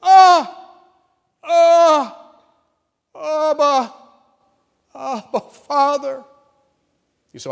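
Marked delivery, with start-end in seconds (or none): 0.0-6.2 high, loud anguished wail of Abba; loud, high wailing Abba, Father; wailing cry. Shown as a demonstration. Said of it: "something reminiscent to this".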